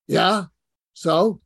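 loud, unimpressed Brooklyn shrug; bored Brooklyn guy, accent. Retold or imitated. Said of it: "thick Brooklyn accent".

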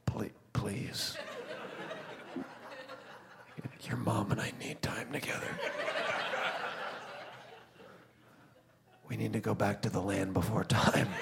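whispered begging, laughing; pleading whisper. Retold or imitated. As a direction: beg in a hushed whisper.

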